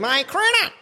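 high, muffled voice as if through a snorkel; Snorkel impression. Mate imitating Snorkel from the port, high snorkel-muffled voice. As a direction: talk like a man with a snorkel in his mouth. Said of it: "I used to love seeing him".

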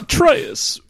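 high, slow actor impression of Kratos; celebrity voice questioning his son; Christopher Walken. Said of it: "Christopher Walken doing his version of, uh, Kratos".

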